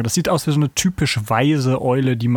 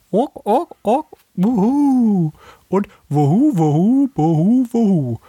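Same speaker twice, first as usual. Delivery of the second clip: higher, louder rhythmic wuhu hoots; owl hooting; 6 semitones higher, 3 dB louder, slower than usual. Shown as a demonstration. Practising the owl calls for the podcast, higher louder hoots. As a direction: hoot it out playfully like an owl. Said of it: "ein rhythmisches Wuhu".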